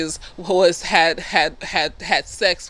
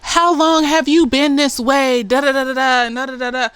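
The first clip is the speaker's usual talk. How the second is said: accusing; 7 semitones higher, 5 dB louder than usual. Retold or imitated. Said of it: "Tick goes up to Montrose and he goes".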